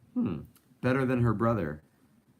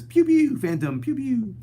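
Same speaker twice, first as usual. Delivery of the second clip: high, loud laser pews; 7 dB louder than usual. Retold or imitated. Streamer reading viewer's laser pews aloud, high zaps. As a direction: read the chat's pews as zaps. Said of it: "Diana says".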